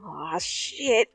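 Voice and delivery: ordinary delivery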